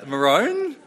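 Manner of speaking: "maroon?" as a loud, high muh-ROHN; emphatic Queensland "maroon?", ending like "roan"; Queensland pronunciation. Shown as a demonstration. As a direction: hit "maroon?" hard with a long oh, like bone. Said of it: "Who's from Queensland here?".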